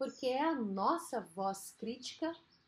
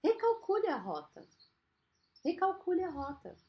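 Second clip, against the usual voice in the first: raised, even GPS prompt; 6 semitones higher than usual. Acted. Driver hears the GPS reroute, composed GPS voice. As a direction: announce it like a navigation system. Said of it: "assim como o G P S".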